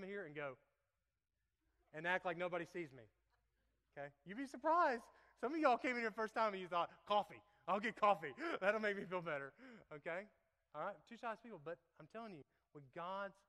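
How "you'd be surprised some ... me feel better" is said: loud, high put-on voice of a shy newcomer; hammed-up loud impression of a newcomer; mock first-timer voice. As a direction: play the nervous visitor, big and silly. Mocking first-timers' thoughts.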